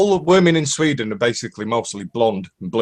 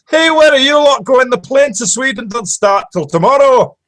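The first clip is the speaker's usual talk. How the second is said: high, loud joke-telling shout; shouting after someone; 10 semitones higher, 5 dB louder than usual. Acted. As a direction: holler after people as they walk out. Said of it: "the professor shouts after him".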